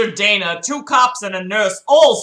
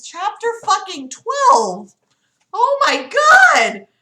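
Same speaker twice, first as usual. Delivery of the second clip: excited disbelief; 12 semitones higher, 5 dB louder than usual. Real, not acted. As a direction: whoop it out in disbelief. Reader amazed at reaching chapter twelve.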